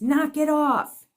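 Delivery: loud, slow, high sharp yell; mother yelling. Retold or imitated. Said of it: "it was in my mother's voice".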